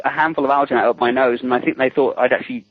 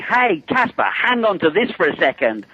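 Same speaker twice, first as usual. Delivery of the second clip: mock yell, pitched well up; retold yell; 7 semitones higher than usual. Yelling to an actor inside the life cast, mock yell. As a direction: call out as if yelling, without real volume. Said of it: "I yell into the thing".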